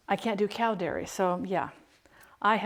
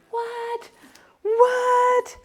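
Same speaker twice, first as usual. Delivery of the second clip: high squeaky what; incredulous; 4 dB louder than usual. Real, not acted. Mom reacting to her son's weird song, high incredulous squeak. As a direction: squeak it out in disbelief.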